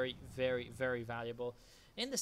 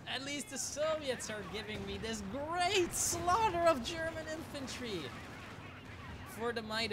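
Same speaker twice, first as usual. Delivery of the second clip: excited; 10 semitones higher than usual. Real, not acted. Cheering Soviet artillery barrage.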